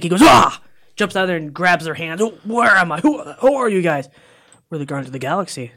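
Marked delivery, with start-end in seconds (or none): 0.2-0.6 short, high, loud cry; mock waking-up scream; startled yell. Rescued man jolting awake among strangers, startled yell. 2.2-4.1 higher, louder bewildered questions; mock startled confusion. Rescued man waking among strangers, startled. Thor wakes confused, acted.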